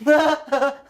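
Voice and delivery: a hollow, forced laugh just for the sound of it; joyless laugh. Shown as a demonstration. Alone, laughing without joy just to hear it. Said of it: "de rire sans joie, rien que pour le son".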